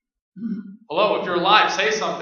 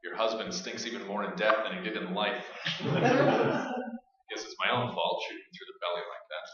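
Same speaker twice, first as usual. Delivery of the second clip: weary low mutter; 10 semitones lower, 13 dB quieter than usual. Acted. Tired survivor monologue.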